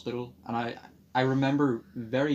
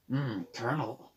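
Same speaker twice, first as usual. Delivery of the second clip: Snake impression; 5 dB quieter, slower than usual. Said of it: "I wasn't like".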